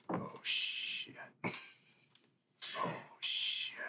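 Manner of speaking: hushed, whispered muttering. A narrator's reading. Onlooker behind the flashlights reacts to the scary man, muttered whisper. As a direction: whisper it low, drawn out in dread.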